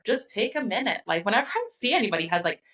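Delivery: normal talking voice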